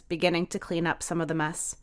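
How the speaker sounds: plain reading voice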